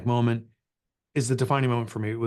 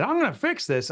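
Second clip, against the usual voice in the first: mock resolve; 11 semitones higher than usual. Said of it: "woke up and said".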